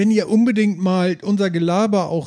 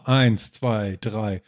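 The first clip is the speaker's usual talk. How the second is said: usual speaking voice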